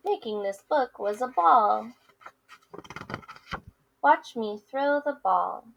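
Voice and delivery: lively picture-book read-aloud with character voices; reading to children. Acted. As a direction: read it to kids, playing both friends. Teacher reads picture book aloud.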